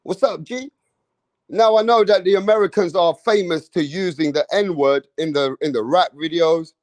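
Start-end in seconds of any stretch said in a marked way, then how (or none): 0.0-0.7 mock American. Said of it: "some states are like".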